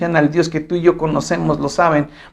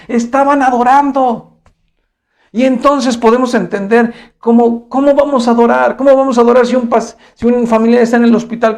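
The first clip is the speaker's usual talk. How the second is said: higher-pitched, urgent sermon delivery; impassioned preaching; 5 semitones higher than usual. Real, not acted. Preacher asks how to worship in a crisis, impassioned. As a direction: press each question on the congregation, pitched up.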